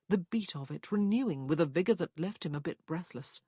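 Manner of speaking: ordinary talk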